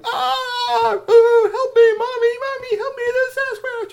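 very high, loud wailing for help; panicked screaming. Acted. Troll teenagers fleeing Sasquatch, screaming in mock terror. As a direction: shriek like a terrified kid calling for mommy.

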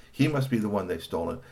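ordinary delivery, nothing marked

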